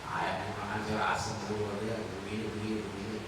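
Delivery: low, soft complaining wife; Ismail's wife grumbles to the stranger, low and soft; grumbling wife. Acted. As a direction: mutter the complaints like an ungrateful wife. Ungrateful wife complains to Ibrahim.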